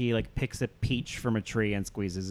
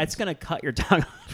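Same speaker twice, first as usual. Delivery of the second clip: half laughing, pitched up a little; laughing mock alarm; 5 semitones higher than usual. To cohosts, retelling her reaction while laughing. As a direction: laugh through the imagined warning. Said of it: "and I was like".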